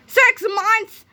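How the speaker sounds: very high, loud outraged shriek; a principal horrified by the delay; shocked scream. A narrator's reading. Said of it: "screamed Croppy the Kid".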